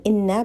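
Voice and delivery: "Inna" with doubled n, rising; "Inna" the wrong way, nn held; wrong Arabic. Shown as a demonstration. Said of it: "make sure you're not saying".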